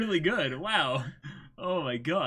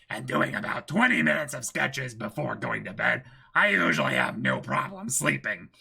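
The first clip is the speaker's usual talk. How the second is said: Kira monologue. Acted. Friend reciting Kira's meme speech, in a measured villain voice. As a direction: recite the killer's calm self-introduction.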